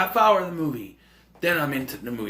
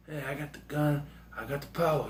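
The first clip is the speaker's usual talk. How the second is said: Seagal whisper; 9 dB quieter, faster than usual. Retold or imitated. Seagal replies calmly.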